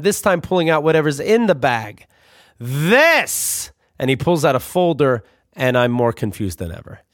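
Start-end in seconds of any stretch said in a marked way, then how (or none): 2.6-3.7 dramatic reveal. Retold or imitated. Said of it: "he tries the drama one more time".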